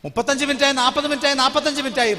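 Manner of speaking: minutes counted up loud and high, growing anxious; rising anxious count. Retold or imitated. Preacher tells the church of waiting for his wife, loud and anxious. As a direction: call out each minute as the worry builds.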